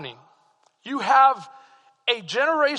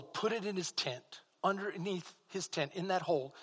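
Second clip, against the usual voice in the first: hushed secretive telling; 9 dB quieter than usual. Retold or imitated. Hiding the stolen spoils.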